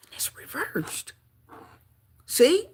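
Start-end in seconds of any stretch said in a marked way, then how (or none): 0.1-1.1 hushed, breathy whisper. Real, not acted. Reader showing the reversed card to viewers, whispered. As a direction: whisper it like a secret.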